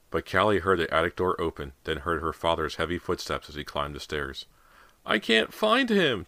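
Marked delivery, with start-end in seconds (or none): 5.1-6.3 high, slow, tearful cry; crying child. A narrator's reading. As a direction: wail like a scared boy. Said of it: "James cried".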